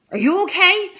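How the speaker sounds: high, loud concerned question; retold concern. Friend checks on her after the scream, high-pitched concern. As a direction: imitate an alarmed friend checking in.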